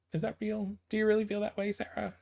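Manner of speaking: high, disbelieving challenge to a character; mock-skeptical needling of a comic character; incredulous. Real, not acted. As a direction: call her bluff, teasing. Talking back to the character.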